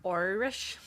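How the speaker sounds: "Irish" as a broad "Oirish"; mock Irish accent. Streamer told they sound Irish, mock Irish "Oirish". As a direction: put on a bad Irish accent, saying "Oirish". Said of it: "I'm literally the worst at accents".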